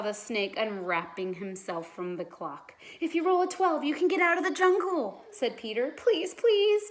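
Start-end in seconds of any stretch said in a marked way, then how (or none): 3.0-5.2 urgent urging. A narrator's reading. Peter urging Judy. 5.9-6.9 high, louder pleading please; Judy begs as she shakes the dice; begging. A narrator's reading. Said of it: "Judy begged".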